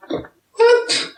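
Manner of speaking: very high, loud squeal of disbelief; high-pitched shocked outburst; incredulous yelp. Real, not acted. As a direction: squawk it in total bafflement. Baffled reaction to the quote.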